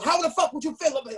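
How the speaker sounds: fast, high mock tough talk from a cop. Cop tough-talking the rapper, fast high mock bark. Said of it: "he's tough talking me".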